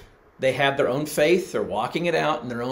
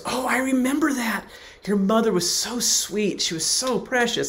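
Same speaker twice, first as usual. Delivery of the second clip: put-on sweet older lady; 9 semitones higher than usual. Elderly Myrna recalls mom fondly.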